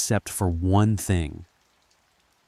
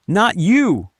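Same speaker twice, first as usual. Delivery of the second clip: high, very loud yell; 13 semitones higher, 9 dB louder than usual. A narrator's reading. Narrator points at the two Lindas, yelling. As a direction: yell it sharply at them. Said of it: "I yelled".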